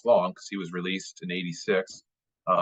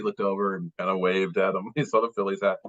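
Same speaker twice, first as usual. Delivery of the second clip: ordinary delivery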